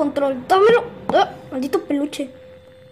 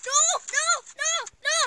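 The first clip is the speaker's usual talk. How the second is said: high panicked noes; 10 semitones higher, 6 dB quieter, faster than usual. Real, not acted. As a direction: cry out in alarm. Panic as enemy enters. (pánico agudo)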